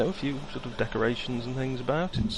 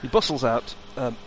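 ordinary talk

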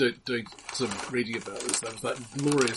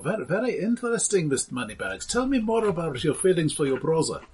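high, lilting analyst voice; hammed-up therapist, probing; mock psychiatrist; 6 semitones higher than usual.

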